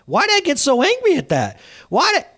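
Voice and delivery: high-pitched, baffled self-questioning; acted self-bewilderment. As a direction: puzzle aloud at your own reaction. Acting out puzzled self-questioning.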